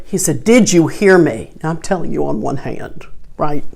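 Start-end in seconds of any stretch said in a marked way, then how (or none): 0.4-1.5 husband's louder, insistent demand; a touch of a raised voice, pushing back. Retold or imitated. Husband insisting to his wife about the gift, mildly raised voice. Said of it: "He has raised his voice".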